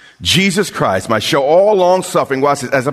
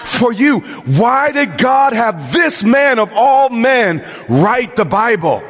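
preaching at full force; 7 semitones higher than usual. Acted. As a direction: hammer each question home to the congregation.

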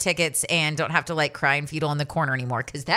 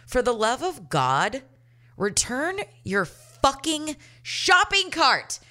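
exasperated plea; 6 semitones higher, slower than usual. Acted. Rant about unreturned shopping carts.

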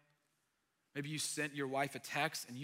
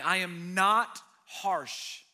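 preaching; 5 semitones higher, 8 dB louder than usual. Acted. Pastor hammers the meaning of gentle to the congregation, preaching. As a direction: drive each line home from the pulpit.

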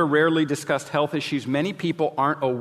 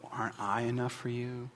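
much softer, hushed mock plea; mock quiet question; 2 semitones lower, 11 dB quieter than usual. Preacher imitating Elkanah to Hannah, soft mock plea. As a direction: murmur it like a self-absorbed aside. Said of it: "terribly insensitive way to try to address people".

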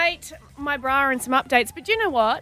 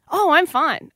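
louder, higher brush-off; breezy reassurance; 4 semitones higher, 8 dB louder, slower than usual. Retold or imitated. Retelling an answer to a worried friend, breezy reassurance. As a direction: quickly wave away the concern.

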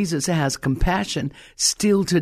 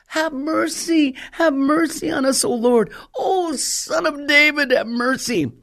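crying out; 8 semitones higher than usual. Retold or imitated. Two blind men beg Jesus for mercy, acted crying out. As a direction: imitate beggars calling out over a crowd. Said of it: "cried out. Can you imagine them?".

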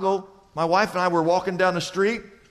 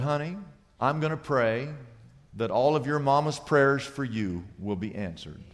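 softer, lower, gentle words to a stranger; tender hush; 7 semitones lower, 5 dB quieter than usual. Retold or imitated. Pastor softly blessing the street singer, tender. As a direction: lean in and bless her quietly.

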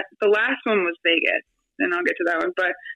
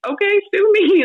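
defiant; 10 semitones higher, 6 dB louder than usual. Retold or imitated. Defiant quote to agents.

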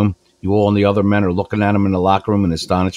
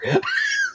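real laugh; 6 dB quieter than usual. Real, not acted. Laughs at own remark.

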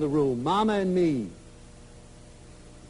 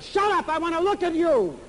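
high, eager mother's voice; insistent mother; 11 semitones higher than usual. Retold or imitated. Mother to her woken kids, high eager insistence. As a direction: brush them off fondly.

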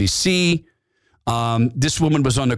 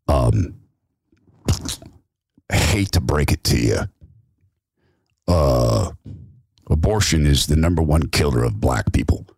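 much lower, grave voice breaking the news; deep objector; 9 semitones lower than usual. Acted. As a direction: drop the voice low like a grim heckler. Objector in back speaks.

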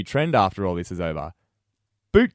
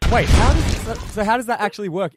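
surprised; 9 semitones higher than usual. Real, not acted. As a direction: caught off guard, asking fast. Host reacts to surprising pineapple fact.

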